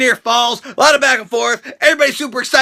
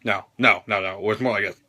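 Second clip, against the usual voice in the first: much softer, low; hushed muttered correction; quiet aside; 8 dB quieter than usual. Real, not acted. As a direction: murmur it while pulling a face. Demonstrating mugshot face.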